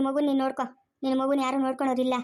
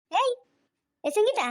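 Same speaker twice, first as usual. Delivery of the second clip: high-pitched, rising call; 9 semitones higher, slower than usual. Acted. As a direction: call to rouse someone. Calling sharply to Sangeetha. (ಎಬ್ಬಿಸಲು ಕೂಗುವ ದನಿ)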